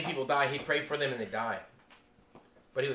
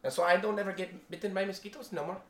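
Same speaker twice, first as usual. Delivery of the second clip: put-on Filipino accent; 6 semitones higher than usual. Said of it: "he used the Filipino accent".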